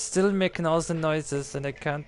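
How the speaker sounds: hammed-up, exasperated German accent. Gamer baffled by weird controls, mock German accent. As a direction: grumble in a put-on German accent. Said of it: "This accent is not going very well".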